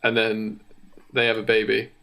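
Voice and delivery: ordinary talk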